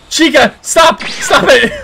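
high, loud stop; panicked shout. Real, not acted. Gamer yelling at Chica to stop. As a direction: yell at the attacker.

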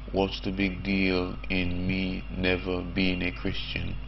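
normal talking voice

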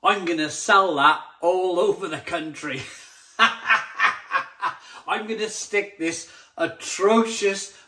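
a loud, laughing, hammed-up salesman; mocking the beer buyer. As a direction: play the smug buyer who loves the beer. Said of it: "And went".

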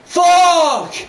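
loud, high, drawn-out curse; explosive cry of frustration; real frustrated yell. Real, not acted. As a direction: shout it at losing the game. Player swears at losing in game.

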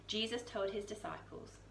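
plain reading voice

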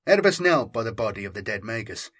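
usual speaking voice

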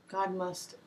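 ordinary talk, nothing marked

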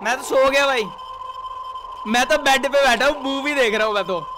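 loud, frantic shouting at the screen; panicked yelling. Real, not acted. Gamer panicking at the stranger breaking in, loud frantic shouting. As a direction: yell in real panic as the door gives way.

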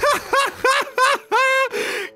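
long high peal of laughter; helpless cackling laugh; mocking laughter. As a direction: keep cracking up at the kid. Dad keeps laughing.

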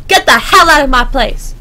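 loud border guard blowing up; mock angry outburst. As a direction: shout them out of your office in mock outrage. Mock tirade at a game traveler.

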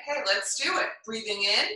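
normal talking voice

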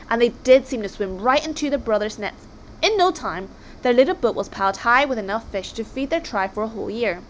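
usual speaking voice

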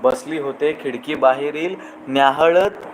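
lingering, lilting recital of verse; poem recitation. Acted. Host reciting a rain poem to his audience, lilting. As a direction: savour each line like falling rain.

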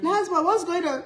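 high, laughing re-enactment; worried mother calling. Retold or imitated. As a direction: check on your child, half laughing. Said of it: "When I call her on the phone".

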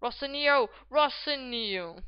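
loud name call, second one drawn out; excited commentator call. Acted. Gamer following his striker's attack, excited name calls. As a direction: call the name loudly, then stretch it slowly.

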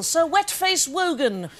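ordinary talk

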